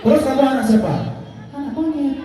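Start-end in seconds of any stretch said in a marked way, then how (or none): 1.5-2.2 high, soft teasing puppet voice; monkey puppet voice. Acted. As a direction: tease like a naughty little monkey.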